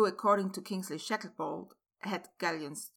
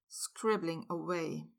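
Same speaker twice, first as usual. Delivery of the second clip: usual speaking voice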